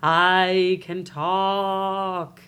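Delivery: mystery phone voice. Acted. Mystery caller to the woman doing laundry at midnight, eerie drawl. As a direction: stretch each word out long and eerie. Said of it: "this voice is like".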